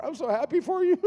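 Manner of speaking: high, whimpering fake happiness; mock tearful joy. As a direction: congratulate them while pretending to cry. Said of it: "They're crying. I'm crying".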